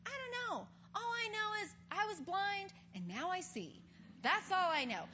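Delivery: a light voice of a simple witness; bewildered healed man. Retold or imitated. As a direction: shrug it off, he only knows he sees. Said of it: "And he's like".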